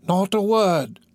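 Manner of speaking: high, drawn-out crone voice; old crone attendant. Acted.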